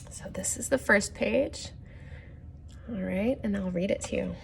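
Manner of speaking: put-on hushed whisper to the kids; soft secret whisper before reading. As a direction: lean in and whisper it. Storyteller hushes before first page.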